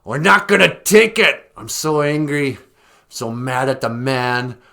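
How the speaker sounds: loud, high ranting protester; hammed-up outrage, trash-the-store energy; mock angry protester. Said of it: "I'm angry".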